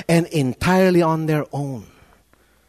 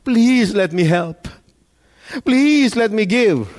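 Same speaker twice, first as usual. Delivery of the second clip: drawn-out, emphatic plea on please; pleading; 4 semitones higher, 4 dB louder, slower than usual. Acted. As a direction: beg earnestly, stretching the please. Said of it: "imagine somebody pleading".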